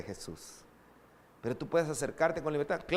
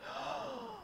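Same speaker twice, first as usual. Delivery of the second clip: mock gasp; 12 dB quieter than usual. Mock shock about math exam.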